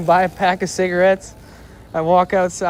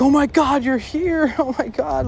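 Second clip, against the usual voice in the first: much higher, excited squeal with a laugh; overjoyed greeting; 9 semitones higher than usual. Retold or imitated. Friends greeting him at the door, excited and high. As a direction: imitate friends overwhelmed with relief.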